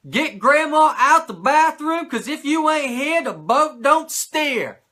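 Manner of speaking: loud, high, slow gruff drawl; booming mock Mongo quote; Mongo impression. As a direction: bellow it like Mongo on commentary. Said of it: "He says".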